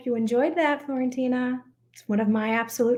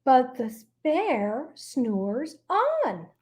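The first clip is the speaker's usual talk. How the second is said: slow refrain; much slower than usual. Acted.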